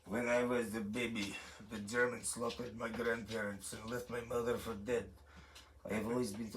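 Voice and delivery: low, soft mock Russian accent; deadpan Russian-accented impression. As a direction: brood through it as a grave Russian. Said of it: "the Russian guy is like".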